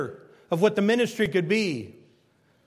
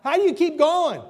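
put-on eager young preacher; 10 semitones higher, 6 dB louder than usual. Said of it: "And I'd say".

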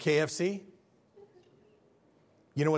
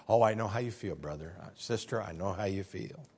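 softer, falsely understanding murmur; mock sympathy; 10 semitones lower, 7 dB quieter than usual. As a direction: sweetly pretend you feel for them. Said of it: "socially acceptable version of anger called frustration".

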